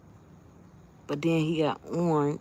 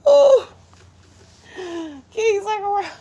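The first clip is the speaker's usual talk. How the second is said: shocked cry; 11 dB louder than usual. Real, not acted. Shock at the bug.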